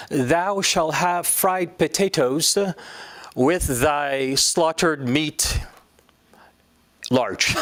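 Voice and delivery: slow, mock old-fashioned ordering; archaic old English. Man from the past orders fries, mock archaic. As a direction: ham up a man from centuries ago. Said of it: "you're three hundred years late".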